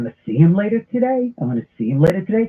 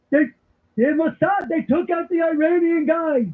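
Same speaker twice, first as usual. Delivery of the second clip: high, exasperated mock holler at a hard-of-hearing dad; hammed-up movie-grouch yelling, strained upward; Walter Matthau yelling; 9 semitones higher, slower than usual. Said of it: "I start yelling like, like Walter Matthau".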